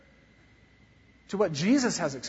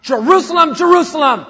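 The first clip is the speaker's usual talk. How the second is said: much louder, higher booming shout; thundering call; 9 semitones higher, 14 dB louder than usual. Shown as a demonstration. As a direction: roar it like thunder. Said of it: "It's not that".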